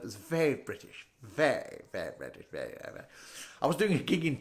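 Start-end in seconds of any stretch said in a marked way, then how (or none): 0.0-3.0 very low, soft posh British; stiff-upper-lip British. Acted. People who think they're better than us, stiff British. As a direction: sink into a hushed, stiff British mumble. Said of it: "They talk with a stiff upper lip".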